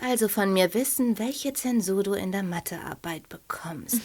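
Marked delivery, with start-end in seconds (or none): 1.2-4.0 quick hushed whisper. Acted. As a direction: whisper it, hurrying at the end. Hushed fortune-teller question.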